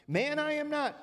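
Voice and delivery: Peter's voice jumps up high in protest; high protesting denial. Acted. Peter insists to the accuser, high and indignant.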